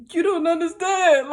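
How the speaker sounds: loud, high, frantic re-enactment; retold freak-out. Daughter to mom in the parking lot, re-enacted freak-out. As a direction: blurt it high and loud, overwhelmed. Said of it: "I freaked out in the parking lot".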